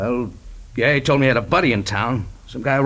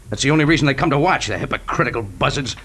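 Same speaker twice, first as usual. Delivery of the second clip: rising anger; 4 semitones higher than usual. Acted. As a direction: accuse him, getting angrier each line. Pilot accusing detective, rising anger.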